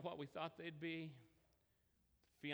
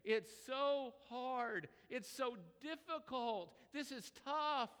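high, drawn-out mock whine of a crying player; imitated tearful complaint; 7 semitones higher, 3 dB louder, slower than usual. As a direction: wail it slowly, acting out the crying freshman. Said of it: "They start crying".

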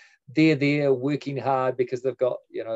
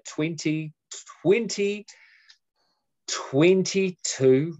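dramatic drawn-out date; 6 semitones higher, much slower than usual. Acted.

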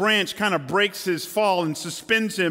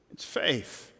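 whisper; 3 semitones lower, 7 dB quieter than usual. Acted. Hushed emphasis in sermon.

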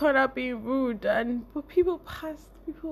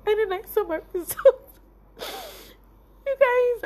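high and loud, voice cracking with joy; bursting with happy tears; overjoyed; 10 semitones higher, 5 dB louder than usual. Real, not acted. Telling viewers about seeing her result, tearful joy. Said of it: "I can't explain my joy".

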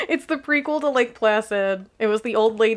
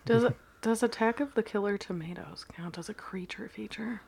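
whispering; 11 dB quieter than usual. Real, not acted. Whispered question in the chat.

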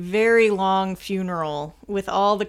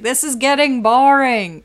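loud, drawn-out gripe; exaggerated complaint about being bored; mock complaint; 5 semitones higher, 8 dB louder than usual. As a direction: complain in a higher, louder, stretched-out voice. Quoting own bored reaction to film.

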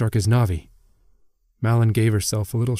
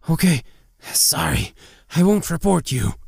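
high, hasty-to-appease apology; cowed, pitched-up retreat; frightened backing down; 8 semitones higher than usual. A narrator's reading. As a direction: back down fearfully under a glare. Backs down under a death glare.

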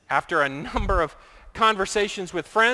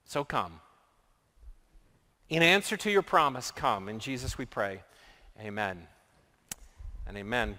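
quiet, low prayer voice; soft, reverent prayer to close; 4 semitones lower, 7 dB quieter than usual. Acted. Closing prayer.

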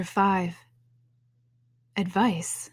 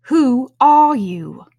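high, slow, loud scornful caterpillar; contemptuous; 6 semitones higher, 9 dB louder, much slower than usual. A narrator's reading. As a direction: draw it out with contempt.

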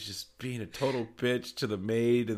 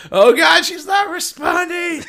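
shrill, much higher and louder panic, slowed; frantic dog owner; 12 dB louder, slower than usual. Retold or imitated. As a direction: play the hysterical owner losing her dog. Quoting frantic owner again.

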